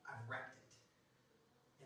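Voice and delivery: very soft, slow, low admission; hushed confession. Acted. Someone admits their life is wrecked, hushed confession. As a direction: confess it almost under the breath.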